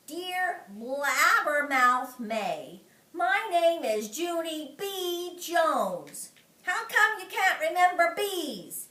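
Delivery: high, slow, loud mocking; slow taunting. Junie leaning in to taunt May, slow and mocking. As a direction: drag out each word to needle her.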